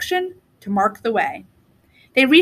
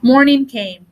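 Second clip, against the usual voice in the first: usual speaking voice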